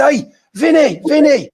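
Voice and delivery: high calling out across a platform; shouting to an old schoolmate down the platform; retold shout. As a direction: call his name high and loud, hailing a friend. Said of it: "I shouted".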